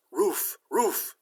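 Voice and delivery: high barked words; two doggy woofs shaped as words; talking dog. Acted. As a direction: bark it out like a dog. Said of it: "The dog says".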